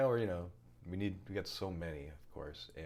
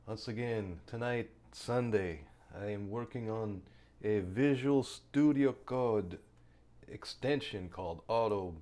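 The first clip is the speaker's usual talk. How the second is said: a mock Finnish accent, played for laughs; ridiculous foreign accent; 3 semitones higher, 5 dB louder than usual. Streamer greets viewers in a silly put-on Eastern European accent. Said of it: "why am I speaking in this ridiculous accent".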